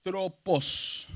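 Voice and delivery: ANTROPOS drawn out syllable by syllable; sounded out slowly. Shown as a demonstration. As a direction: read "Antropos" very slowly, part by part. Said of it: "ya estamos un poquito más familiarizados".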